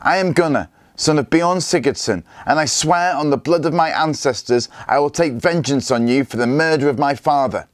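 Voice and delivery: put-on boy swearing a blood oath at Scully; furious oath. A narrator's reading. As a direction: swear it through rage. Gunnar lashing out, swearing vengeance.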